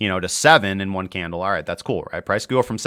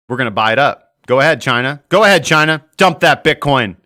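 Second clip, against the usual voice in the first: building from raised voice to a loud, higher taunt; loud mock dare; 4 semitones higher, 8 dB louder than usual. Imagined country daring China to dump Bitcoin, loud mock challenge. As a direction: goad them on, louder with each phrase.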